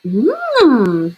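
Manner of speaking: satisfied mmm. A narrator's reading. Enjoying the soup.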